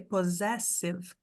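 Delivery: possessive slowed, first SS as Z, second as S; slow English demo. Shown as a demonstration. Teacher breaking down possessive for students. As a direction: say possessive slowly, Z then S. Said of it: "primeiro parzinho de S tem som de Z".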